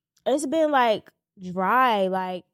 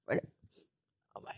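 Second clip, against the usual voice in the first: choked up; 13 dB quieter than usual. Real, not acted. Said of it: "why I was tearing up".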